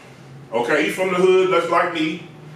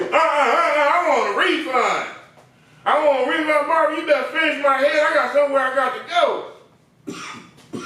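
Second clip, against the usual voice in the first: higher, indignant refusal; imitating an annoyed client; 6 semitones higher than usual. Client demands a refund from the barber, mock indignant. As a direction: demand the money back. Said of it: "was like this".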